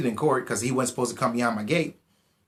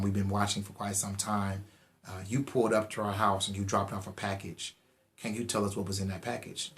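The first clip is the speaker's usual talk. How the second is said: low, soft coaxing cop voice; smooth and quiet, trying to win him over; good cop; 4 semitones lower, 8 dB quieter than usual. Retold or imitated. Good cop coaxing the suspect, low and soft. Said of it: "the good cop comes over".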